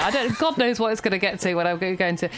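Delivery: normal talking voice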